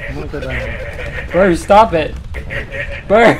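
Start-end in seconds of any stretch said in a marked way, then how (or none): 1.3-3.4 calling out. Real, not acted. Calling out to teammate.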